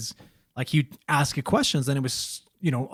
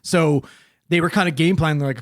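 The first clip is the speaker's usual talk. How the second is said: ordinary delivery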